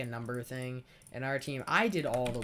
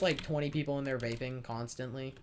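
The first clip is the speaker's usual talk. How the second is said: ordinary talk, nothing marked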